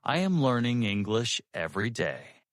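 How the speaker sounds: one connected, even conversational phrase; medium flow. Shown as a demonstration. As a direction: let the sentence flow without breaks. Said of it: "medium flow".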